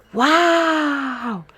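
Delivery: loud, drawn-out wow of wonder; amazed wow. A narrator's reading. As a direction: marvel out loud like a delighted child. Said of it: "said Spot".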